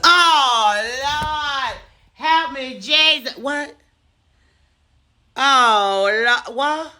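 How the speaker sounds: hammed-up despair; mock anguished wail. Reacting to positive covid test.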